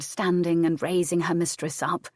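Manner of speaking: usual speaking voice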